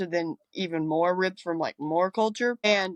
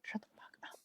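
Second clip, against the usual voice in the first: whisper; 20 dB quieter, faster than usual. Retold or imitated. Annoyed retort to the diva quote.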